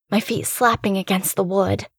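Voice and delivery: ordinary delivery, nothing marked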